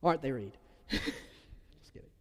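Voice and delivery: ordinary talk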